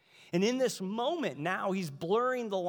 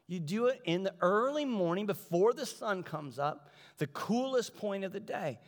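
usual speaking voice

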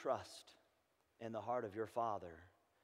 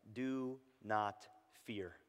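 very slow, word by word; measured reassurance; 2 semitones lower, 3 dB quieter, much slower than usual. Acted. Preacher voicing God's answer to the cry, slow and measured. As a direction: space out each word, easing down.

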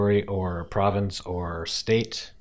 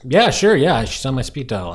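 higher and louder, mock-eager sarcasm; sarcastic reply; 6 semitones higher, 8 dB louder than usual. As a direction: play along with a ridiculous idea, dripping sarcasm. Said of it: "that's kind of my sense of humor".

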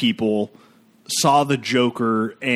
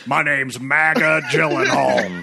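Alex Jones voice; slower than usual. Pretend. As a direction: bellow it like a conspiracy talk host. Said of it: "'cause it's Alex Jones im-, impression".

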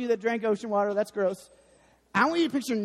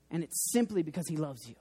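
soft hushed take; 5 semitones lower, 7 dB quieter than usual. Shown as a demonstration. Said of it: "repeated line, softer first take".

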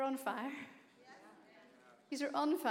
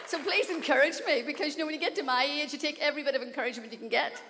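laughing plea; 4 semitones higher, 8 dB louder, faster than usual. Real, not acted. Joking plea after boast.

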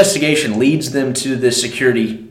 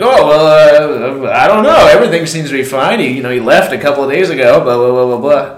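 mock evasive; 3 semitones higher, 7 dB louder than usual. Security guys feigning innocence.

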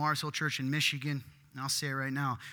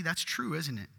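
ordinary talk, nothing marked

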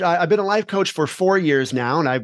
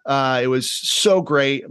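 ordinary delivery

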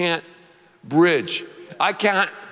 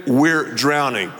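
we're drowning said slower and weighty; slow repeat; 3 semitones lower than usual. Shown as a demonstration. Preacher leading the crowd in a slow repeat. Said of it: "Once again".

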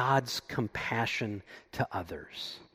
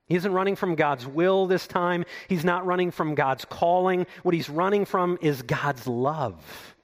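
normal talking voice

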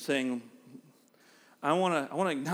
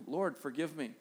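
much softer, humble plea; soft prayer; 7 dB quieter than usual. Retold or imitated. Preacher modelling a penitent prayer, soft and humble. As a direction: pray it quietly, humbly.

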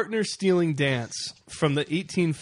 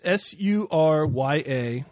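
spelling it out; slower than usual. Shown as a demonstration. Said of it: "How do you spell that again?".

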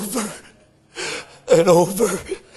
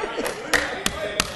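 soft laugh; 6 semitones higher, 10 dB quieter than usual. Real, not acted. Preacher's laugh.

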